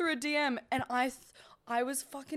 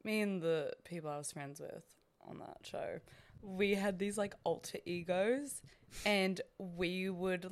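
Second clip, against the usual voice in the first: normal talking voice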